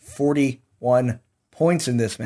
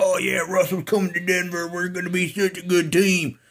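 higher, naively overconfident fan voice; mocking Broncos fan; 6 semitones higher than usual. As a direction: parody a blindly hopeful fan. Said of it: "that's how Broncos fans sound".

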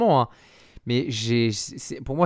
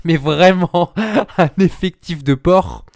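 laughing through the words; giggling while mocking the squad; 4 semitones higher, 7 dB louder, slower than usual. Real, not acted.

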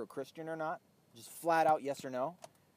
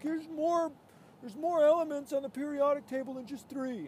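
high, nasal-free mock pedant voice pitched way up; mocking whiny know-it-all; 12 semitones higher, 7 dB louder than usual. Mocking the people who get bent out of shape, whiny high voice. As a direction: sneer it out like a smug know-it-all. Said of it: "'cause they're like".

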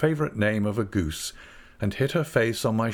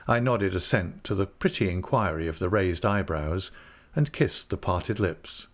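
plain reading voice

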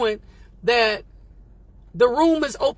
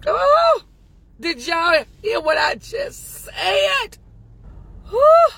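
excited shout; 9 semitones higher, 4 dB louder, slower than usual. Real, not acted. Hypes herself to listeners.

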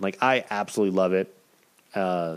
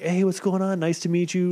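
high-pitched imitation of a polite hello; respectful friendly greeting; 8 semitones higher than usual. Visiting wrestler meeting people at the show, respectful and friendly. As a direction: mimic a nice guy greeting someone new. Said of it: "like, just respectful".

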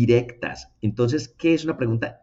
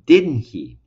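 tag question demo; 9 dB louder than usual. Shown as a demonstration. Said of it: "entonces digo".

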